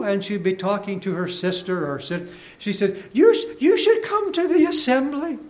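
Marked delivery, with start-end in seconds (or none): 3.1-5.4 much higher woman's voice, a bit louder; mock high woman's voice; high-pitched wife. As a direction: imitate the wife urging her sister up high. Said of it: "in her high-pitched voice".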